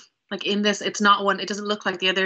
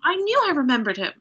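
higher-pitched, eager revelation; breaking big news, pitch raised; excited, in character; 10 semitones higher than usual. Acted. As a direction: blurt out a wondrous discovery to an old friend. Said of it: "I'm gonna say".

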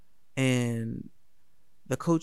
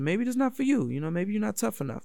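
ordinary delivery

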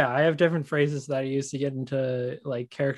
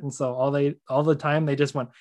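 ordinary talk, nothing marked

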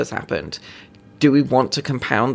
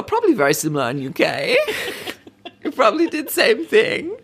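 laughing through a mock Norwegian accent, higher; bad Norwegian accent; 9 semitones higher than usual. Podcaster repeating the Norwegian police chief's jab, laughing bad accent. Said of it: "I'm gonna do a bad accent".

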